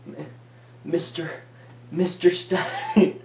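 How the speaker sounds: drawn-out, halting, high and strained; stammering horror. Retold or imitated. Boy terrified calling teacher over vomiting girl, stammering. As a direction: struggle to force the teacher's name out. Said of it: "that's how I was saying it".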